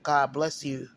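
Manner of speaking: ordinary delivery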